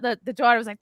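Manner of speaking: ordinary talk, nothing marked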